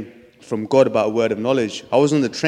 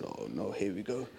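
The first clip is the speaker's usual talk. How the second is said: under breath; 4 semitones lower, 18 dB quieter than usual. Retold or imitated. Breathing in before speaking.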